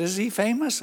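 high, innocent rising question; clueless secretary. Retold or imitated. Secretary asking pastor about the caller, naively curious.

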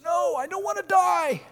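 high-pitched panicked wail; frightened inner voice. Acted. Part of us dreading death protests, in a high wail. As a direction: squeal it in mock terror. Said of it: "that part of us that says".

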